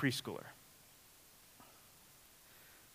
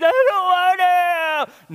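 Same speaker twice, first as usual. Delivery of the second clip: put-on whiny child; 12 semitones higher, 9 dB louder, slower than usual. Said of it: "you telling your kid".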